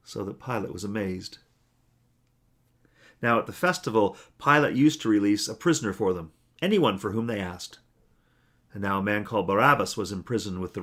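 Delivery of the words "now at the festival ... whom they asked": ordinary delivery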